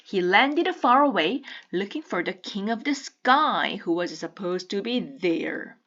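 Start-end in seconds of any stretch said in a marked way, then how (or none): none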